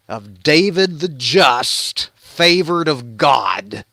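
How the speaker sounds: slow, portentous storyteller ending; grand tale closing. Acted. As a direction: intone the title with long pauses.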